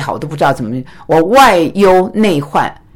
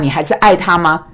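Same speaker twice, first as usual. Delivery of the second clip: ordinary delivery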